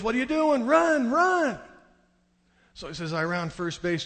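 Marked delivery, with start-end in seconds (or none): 0.0-1.6 very high, loud urgent shout; dad shouting. Retold or imitated. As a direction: holler like a dad urging a runner. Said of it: "My dad goes".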